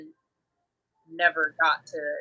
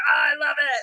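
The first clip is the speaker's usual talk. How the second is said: gushing delight; 8 semitones higher, 4 dB louder than usual. Retold or imitated. Gushing over working with adults.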